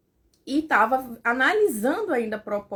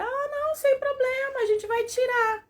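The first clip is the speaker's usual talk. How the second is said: much higher, airy doctor voice; breezy doctor. Retold or imitated. Gynecologist brushing off the patient's worry, breezy. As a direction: toss it off like a carefree doctor.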